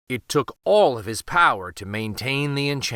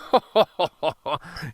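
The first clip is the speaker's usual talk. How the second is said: a short, contemptuous villain's laugh; scoffing laugh; 3 semitones higher than usual. Acted. Vizier mocking the peasant girl's boast with a scornful laugh. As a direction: laugh the girl off, sneering. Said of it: "scoffed the Grand Vizier".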